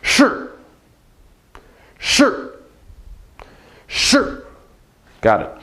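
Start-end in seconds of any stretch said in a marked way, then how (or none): 0.0-4.5 是 said as a breathy, voiceless shi; repeated model. Shown as a demonstration. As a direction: repeat 是 with a hushed sh sound. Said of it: "let's repeat that".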